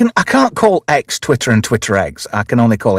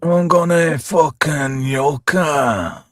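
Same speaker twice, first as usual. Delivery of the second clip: slow, drawling Scottish comic character; Dee Dee; 3 semitones higher, much slower than usual. Retold or imitated. Host quotes a Limmy show character, drawling Scottish impression. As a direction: imitate a spaced-out Scottish sketch character, words stretched out. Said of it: "I was doing Dee Dee".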